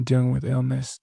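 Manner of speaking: usual speaking voice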